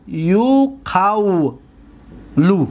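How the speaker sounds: یو قولو split as yu-qaw-lu, very slow; slow spelled-out form. Shown as a demonstration. Teacher slowly sounding out the derived form. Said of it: "teacher sounds out new form slowly".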